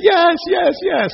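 high, excited yes yes yes. Retold or imitated. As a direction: squeal with delight. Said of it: "She says".

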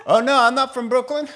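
high, loud, quick protest; comic suspect protesting in a hurry; mock innocent denial. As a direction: deny it too eagerly, for laughs. Suspect acted denying his origin.

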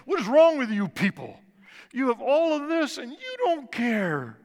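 high, loud furious shout; raging outburst. Retold or imitated. Teen raging at rich New Yorkers, loud and high. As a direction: let the rage at the passers-by burst out. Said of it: "I went into a rage".

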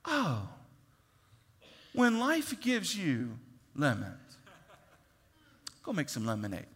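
mock-casual know-it-all; glib advice. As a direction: toss off the cliché with a chuckle. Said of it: "the dude that's like".

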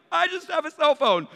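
very high, loud, flustered excuse; mock-terrified protest, voice shooting up; panicked squeal. Retold panicked answer to officer.

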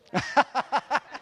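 short high burst of laughter; a quick amused laugh; real laugh. Real, not acted. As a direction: laugh at your own joke. Laugh after the joke.

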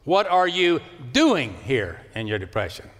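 doing pushed hard and falling; What are you doing here in your depression? with doing stressed. Shown as a demonstration. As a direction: lean into doing. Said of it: "now say it like this".

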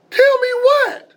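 very high, loud, stretched-out disbelief; stunned son, voice shooting up; shocked. Retold or imitated. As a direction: shriek the question in alarm. Learns father hid surgery.

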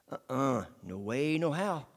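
ordinary talk

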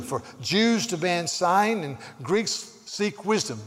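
loud, slow, emphatic preaching; weighty pulpit reading, each key word punched; forceful scripture reading. Real, not acted. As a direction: proclaim the verse to a congregation.

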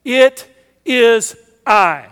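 each word slow and weighty, "It is I." drawn out; unhurried, solemn "It is I."; slow emphatic. Shown as a demonstration. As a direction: lay out "It is I." slowly like a proclamation. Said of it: "Look at the text".

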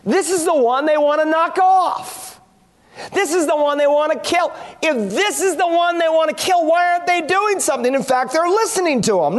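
a touch of baffled disbelief, voice lifted; confused. Retold or imitated. Preacher voicing the crowd's second thoughts, confused. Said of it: "So they're confused".

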